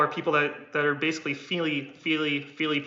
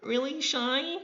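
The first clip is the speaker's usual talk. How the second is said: high, soft and timid; shy meek voice; 11 semitones higher, 5 dB quieter than usual. Shown as a demonstration. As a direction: sound small and scared. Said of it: "I sounded like this".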